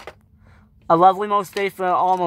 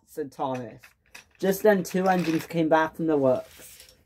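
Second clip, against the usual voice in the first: usual speaking voice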